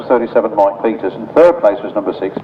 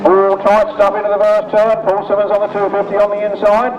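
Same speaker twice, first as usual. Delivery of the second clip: raised, lively play-by-play; live race commentary; 7 semitones higher than usual. Real, not acted. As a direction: call the race as it happens.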